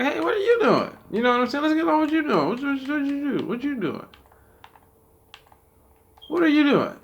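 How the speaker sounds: high mock voice of a nosy busybody. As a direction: parody someone prying into your business. Mocking nosy people.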